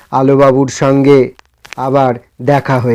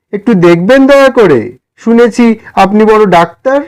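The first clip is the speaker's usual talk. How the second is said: raised, pleading voice of a humble poor man; timid poor man; 5 semitones higher, 3 dB louder than usual. Acted. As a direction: ask shyly for a favour, a bit higher. (বিনীত গরিব মানুষের কুণ্ঠিত অনুরোধ)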